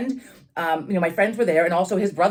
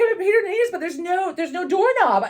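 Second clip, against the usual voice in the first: desperate plea; 11 semitones higher than usual. Retold or imitated. Desperate explaining to dad.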